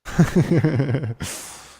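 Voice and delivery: high, loud burst of laughter. Real, not acted. Laughing at the table.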